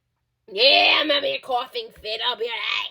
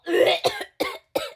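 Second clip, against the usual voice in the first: hacking cough. Acted. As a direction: cough hard like a heavy smoker. Smoker's coughing fit.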